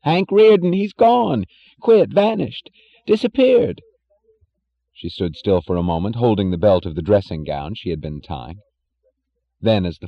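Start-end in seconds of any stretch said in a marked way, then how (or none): 0.0-3.8 high, loud, frantic man's voice; panicked Jim. A narrator's reading. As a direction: rattle it off in rising alarm. Jim panics about Rearden.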